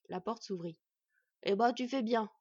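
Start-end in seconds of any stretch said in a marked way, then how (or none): 1.4-2.3 loud grumpy dwarf; grumbling. A narrator's reading. Dwarf poking his head out, loud grumbling. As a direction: grumble it at an old acquaintance. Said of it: "ronchonna le dverg".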